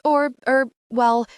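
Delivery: high, loud, nervous hesitation; scratchy with nerves. A narrator's reading. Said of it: "my voice is scratchy with nerves".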